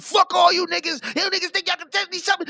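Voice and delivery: high, fast mock outburst; mock defiant rant. Host mimics Biz cussing out his critics, high fast outburst. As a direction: imitate him turning tough and shouting back.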